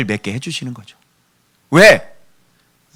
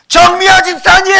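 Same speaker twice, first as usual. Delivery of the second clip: high, loud holler of a name; crying out; 11 semitones higher, 5 dB louder than usual. Shown as a demonstration. Preacher demonstrates calling a deaconess standing nearby, crying out. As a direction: bellow at someone standing right there. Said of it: "맨날 부르짖어".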